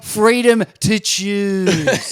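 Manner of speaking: mock-grand echo. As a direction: stretch it into a mocking proclamation. Mocking echo of the quote.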